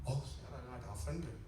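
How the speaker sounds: ordinary talk, nothing marked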